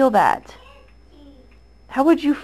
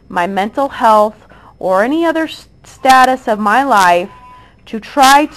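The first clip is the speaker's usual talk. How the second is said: loud; 8 dB louder than usual. Real, not acted. Angry rant at accusers.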